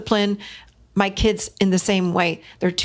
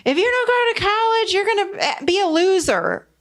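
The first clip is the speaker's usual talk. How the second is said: much higher, harsh lecturing parent voice; scolding parent; 12 semitones higher than usual. Retold or imitated. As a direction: snap at the kid like a panicked parent. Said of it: "the parent would jump right in there and go".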